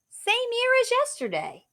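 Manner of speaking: high, bright answer. Acted.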